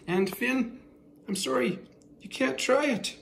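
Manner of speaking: sing-song voice for the dog; high pet-talk voice. Real, not acted. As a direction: talk to him like a puppy. Talking sweetly to the pet dog.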